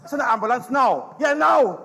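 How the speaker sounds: loud, urgent demand; panicked call for an ambulance; urgent shout. Acted. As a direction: yell down the phone for help.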